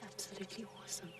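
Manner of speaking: quick breathless whisper; awed whisper. Real, not acted. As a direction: whisper it in quiet wonder. Quiet awe after the sighting.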